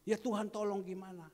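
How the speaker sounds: desperate cry. Retold or imitated. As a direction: cry out to God. (seruan putus asa)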